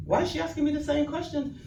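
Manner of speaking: re-enacted indignant query; indignant question. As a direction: question it in mock disbelief. Retold challenge to call recording.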